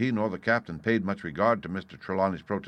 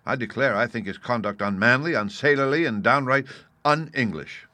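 squire's mild outburst, building higher; indignant cry; 4 semitones higher, 7 dB louder than usual. A narrator's reading. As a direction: denounce him, hitting each insult harder. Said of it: "cried the squire".

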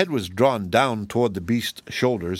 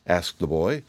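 ordinary delivery

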